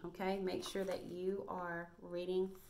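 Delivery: usual speaking voice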